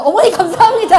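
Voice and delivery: loud, high laughing thank-you; laughing mock thanks. A teacher mimicking a parent, laughing loudly. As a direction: blurt the thanks through laughter.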